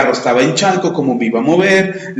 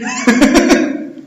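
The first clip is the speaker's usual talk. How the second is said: laugh; 10 semitones higher than usual. Real, not acted. Laughs at himself.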